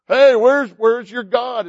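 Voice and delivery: loud, jeering call across the altar; mocking taunt. Elijah taunting Baal's prophets with a loud mocking shout. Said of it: "he starts making fun of them".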